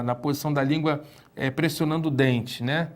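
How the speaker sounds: ordinary delivery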